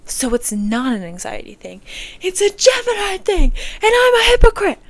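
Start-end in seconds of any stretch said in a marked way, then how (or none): 2.2-4.8 very high, loud squeal; squeaky joke voice. Pretend. Joking self-mocking squeal.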